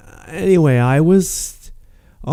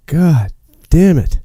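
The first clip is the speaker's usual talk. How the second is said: slow, exasperated curse; irritated; 4 semitones higher, 3 dB louder, slower than usual. Real, not acted. Fighting to show the picture, irritated. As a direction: drag the curse out, really annoyed. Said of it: "they're so irritating".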